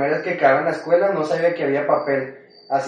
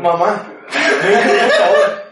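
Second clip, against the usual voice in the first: drawn-out whiny plea, higher, louder and very slow; mock crying plea; 5 semitones higher, 6 dB louder, much slower than usual. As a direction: wail it out like a desperate kid, laughing. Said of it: "Llorando, llorando". (súplica llorona exagerada, lenta y aguda)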